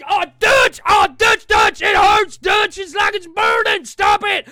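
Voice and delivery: high, loud yelps of pain; hammed-up screaming that it burns; pained yelling. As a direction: cry out as if scalded. Arthur sprayed by power washer.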